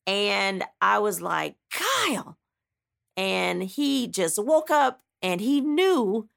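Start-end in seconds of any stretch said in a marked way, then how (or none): 1.7-2.4 calling his name high and sharp; waking him with a sharp, furious call; sharp angry call. Retold or imitated. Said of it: "for me to talk to him like that".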